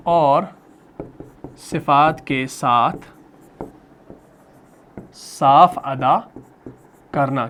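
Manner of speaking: chunk the definition word by word, very slowly, with long pauses; slow dictation. Shown as a demonstration. Teacher dictating a definition to students, very slow and chunked. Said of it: "हरफ को मखरज और सिफात के साथ साफ अदा करना".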